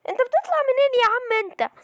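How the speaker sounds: high-pitched, startled exasperation; alarmed protest. Real, not acted. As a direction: protest in a panic at the sudden attacker. Confronts enemy popping up suddenly. (باعتراض مفزوع)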